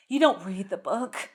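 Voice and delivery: slow, drawn-out mock gravity; mock-dramatic movie line. Quoting the freaking-out girl's stupid line, mock dramatic. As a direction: lightly send up an overwrought line. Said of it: "She's crying. She's like".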